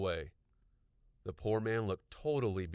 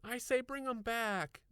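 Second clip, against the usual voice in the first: anxious. A narrator's reading. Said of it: "said anxiously".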